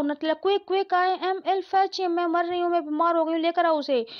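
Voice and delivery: high-pitched, frantic begging; a half-acted hysterical fit; hysterical pleading. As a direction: plead frantically to fetch him back. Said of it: "Hysteric हो रही है वो".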